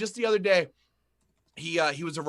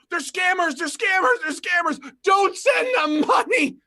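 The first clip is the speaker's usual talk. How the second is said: high shouted mock chant of they're scammers; mocking the opponents; 11 semitones higher, 6 dB louder than usual. Mocking the politicians' attack, a high loud mock shout. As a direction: mimic the politicians crying scam, loud and high. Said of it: "their big, desperate attack is".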